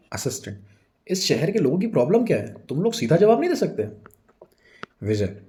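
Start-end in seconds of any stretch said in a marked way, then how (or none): 1.1-3.9 high-pitched, exasperated complaint; irritated. Acted. Assistant complains to the shop boy, irritated. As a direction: protest in irritation, pitch climbing. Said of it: "काफ़ी irritation होती है".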